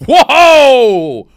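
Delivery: loud, high hammed-up whoa; a big fake gasp of a whoa; mock shock. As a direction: overplay the surprise.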